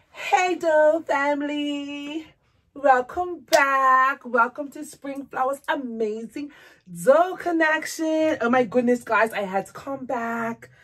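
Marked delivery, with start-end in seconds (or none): 0.1-8.4 slow vlog greeting. Real, not acted.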